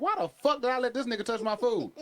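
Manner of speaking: high, laughing disbelief; mock horror through laughter; shocked self. Retelling seeing the cook, high laughing disbelief. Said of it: "I was like".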